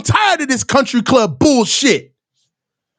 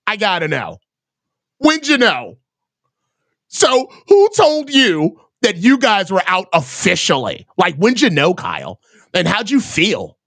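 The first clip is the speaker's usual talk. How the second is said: mock reporter; 7 semitones lower than usual. Mocking reporters' questions.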